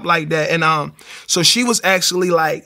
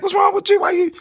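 much higher, baffled mock squeal; incredulous wife. Wife to husband praising God, imitated high bewilderment. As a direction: sound like she thinks he's lost it. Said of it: "she looked at me like".

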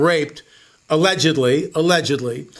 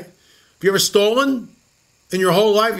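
ordinary talk

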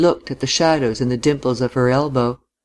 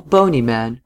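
ordinary delivery

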